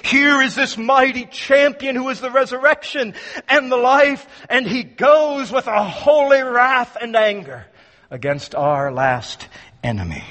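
usual speaking voice

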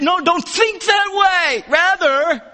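emphatic shout. Retold or imitated. Preacher voicing Paul's denial to the congregation, shouting. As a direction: thunder it out as a flat refusal. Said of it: "Emphatically denies".

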